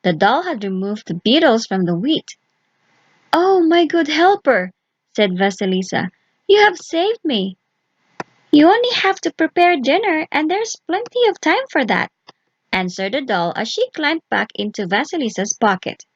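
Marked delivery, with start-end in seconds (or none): 3.3-4.7 put-on higher, relieved girl's voice; grateful young girl. Vasilisa thanking her doll, relieved and higher. 6.5-7.6 put-on higher, relieved girl's voice; grateful young girl. Vasilisa thanking her doll, relieved and higher. 8.5-12.1 high, gentle doll voice; kind little doll. Acted. Doll comforting Vasilisa at night, high and gentle.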